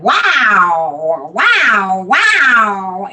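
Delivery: imitating an excited toddler's long, looping wow; excited baby grandson. Grandmother retells her baby grandson's walker ride, imitating his excited wows. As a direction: draw each wow out, swooping up and down. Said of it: "he said".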